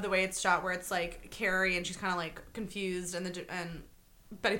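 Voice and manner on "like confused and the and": ordinary talk, nothing marked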